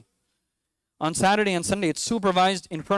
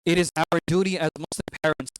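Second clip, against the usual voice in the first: ordinary talk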